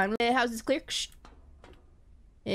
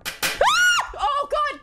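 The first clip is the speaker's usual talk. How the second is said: sudden shrill scream then gasped God; real jump-scare shriek; startled scream; 11 semitones higher, 5 dB louder, much slower than usual. Real, not acted. Gamer ambushed in the dark house, screaming in fright.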